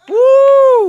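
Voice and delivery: high held shout. Real, not acted. Preacher crying out God's words, held shout. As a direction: hold the word out loud.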